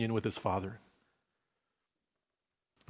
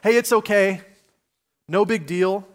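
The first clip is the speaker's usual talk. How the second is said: high, loud and airy, waving it away; high, loud carefree shrug of a voice; breezy brush-off; 7 semitones higher, 5 dB louder than usual. Retold or imitated. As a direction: brush off the wrong like it's nothing, brightly. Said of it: "We tend to go".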